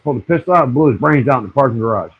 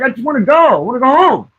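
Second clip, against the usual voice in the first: mock pleading wife; 9 semitones higher than usual. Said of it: "She's like".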